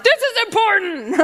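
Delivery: high, indignant squeal; exasperated God. Retold or imitated. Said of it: "He's like".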